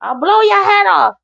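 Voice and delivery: panicked threat. Retold or imitated. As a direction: shriek it like a man cornered at the door.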